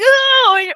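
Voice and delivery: high groaning oh of despair; a hammed-up, high wail of oh; mock despairing oh; exasperated oh. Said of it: "And you're like".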